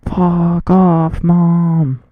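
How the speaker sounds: loud shout at his mom; yell. Real, not acted. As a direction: bark it out, fed up. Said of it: "I just wanna yell right now".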